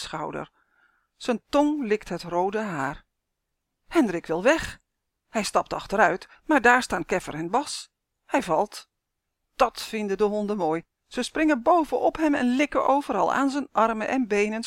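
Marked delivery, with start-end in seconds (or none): none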